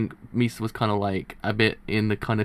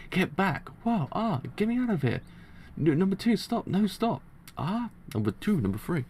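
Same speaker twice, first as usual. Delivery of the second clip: high-pitched, contained cries of alarm; lightly acted fright, pitched up; mock panic; 8 semitones higher, 4 dB quieter than usual. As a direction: act out a small panicked yelp. Reads panicked manga dialogue aloud.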